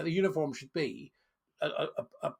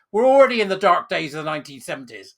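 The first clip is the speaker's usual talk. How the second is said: loud indignant retort; 11 dB louder than usual. Real, not acted. Angry retort.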